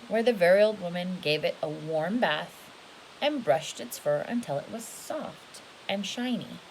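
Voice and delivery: plain reading voice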